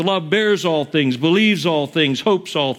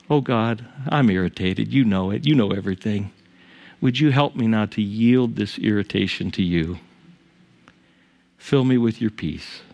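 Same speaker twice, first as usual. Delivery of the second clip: lower, softer, earnest prayer to God; quiet demo prayer; 5 semitones lower than usual. Shown as a demonstration. Pastor modelling a prayer for the congregation, soft and humble. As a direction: pray it humbly, almost under your breath. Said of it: "just pray".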